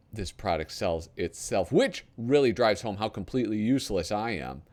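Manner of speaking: lightly hammed-up TV pitchman read; infomercial read. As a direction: sell it like a late-night ad. Reads a parody TV ad.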